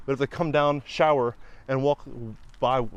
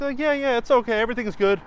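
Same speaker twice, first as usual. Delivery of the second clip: high, breezy yes-man voice, lightly imitated; mock reassurance; 9 semitones higher, 4 dB louder than usual. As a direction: brush off every worry like a smooth inspector. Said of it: "they're like".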